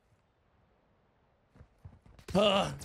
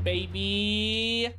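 hyped sign-off; 5 semitones higher than usual. Real, not acted. Hyped sign-off asking for subs.

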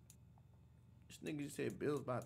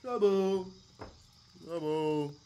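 echoing the clip; 8 dB louder, much slower than usual. Retold or imitated. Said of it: "echoes clip's line".

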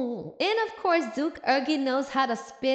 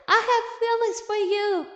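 blurted high and a bit louder; mock screamed confession; 8 semitones higher, 4 dB louder than usual. Rashta confessing to the departing Duke, mild mock scream. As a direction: blurt it out high for everyone to hear. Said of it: "Rashta is screaming it out".

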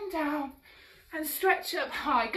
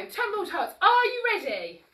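ordinary talk

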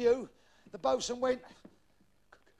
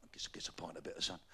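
hushed; 11 semitones lower, 10 dB quieter than usual. Acted. Barman's quiet reply.

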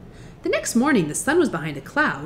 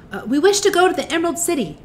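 put-on girl voice; 9 semitones higher, 4 dB louder, faster than usual. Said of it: "said the child".

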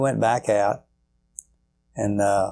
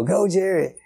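imitated holler; 8 semitones higher than usual. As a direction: mimic a fan calling to the stage. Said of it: "he just hollered all through the show".

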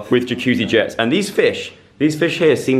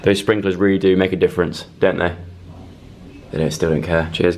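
ordinary talk, nothing marked

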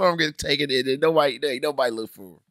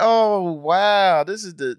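mock gloating; 7 semitones higher, 4 dB louder, much slower than usual. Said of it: "It's like".

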